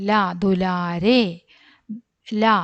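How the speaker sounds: drawn-out syllables, one at a time; slow sounding out. Shown as a demonstration. Teacher reading each syllable slowly to pupils. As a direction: spell it out slowly for the class. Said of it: "teacher sounds out the syllables".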